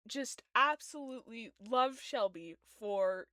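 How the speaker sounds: ordinary talk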